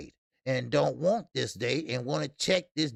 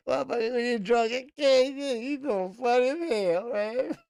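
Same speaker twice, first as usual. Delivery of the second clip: laughing talk; 7 semitones higher than usual. Real, not acted. Laughing at viewer's joke.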